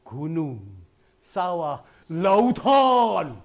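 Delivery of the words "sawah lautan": "lautan" much louder and very slow, falling; stress on lautan. Shown as a demonstration. Teacher showing lautan as the favourite word. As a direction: lean long and loud on "lautan". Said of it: "kalau bisa begitu".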